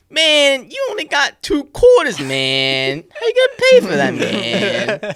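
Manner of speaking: high, loud, whiny-pitched mimicry; exaggerated high-voiced customer impersonation; complaining customer. Acting out the complaining customer at cashier.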